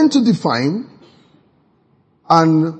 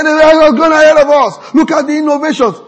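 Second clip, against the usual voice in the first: high, loud imitation of the man's claim; mock confident guy; 11 semitones higher, 7 dB louder than usual. A man lecturing the speaker about the West, lightly mocked. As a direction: puff up his argument. Quoting the guy's argument.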